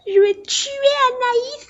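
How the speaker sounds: mock panicked mom, high and slow; imitating mom. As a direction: parrot mom's meltdown. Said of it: "t'étais en mode".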